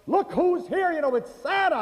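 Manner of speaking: put-on high, bright teacher call to the kids; teacher presenting Santa to the class, excited; excited announcement.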